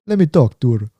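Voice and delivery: badly faked Jamaican accent; terrible Jamaican accent. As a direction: imitate a Jamaican friend, accent put on clumsily. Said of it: "this is a terrible Jamaican accent".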